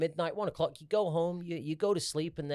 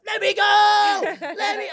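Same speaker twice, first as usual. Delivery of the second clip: high, loud squawking shout; frantic hammed-up pigeon shriek; panicking pigeon; 11 dB louder, slower than usual. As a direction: screech like a trapped bird. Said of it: "he was just going, like".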